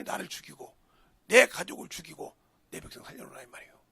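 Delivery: ordinary talk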